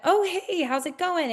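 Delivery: cheerful realtor hello; excited hello. Retold or imitated. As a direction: pop up high like greeting a friend. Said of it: "when you have that".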